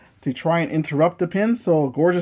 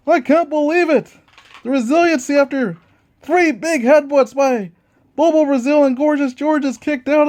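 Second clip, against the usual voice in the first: excited ringside play-by-play, higher on near falls; wrestling commentator; 10 semitones higher, 5 dB louder than usual. Acted. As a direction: hype every kick-out like a TV announcer.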